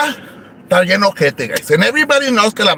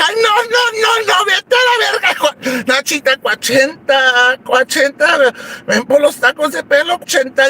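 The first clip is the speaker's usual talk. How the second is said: very high panicked pleading; terrified squeal; 7 semitones higher than usual. Retold or imitated. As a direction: squeal in fright at the ghost. Se asusta de Nachita en el panteón. (chillido agudo de terror)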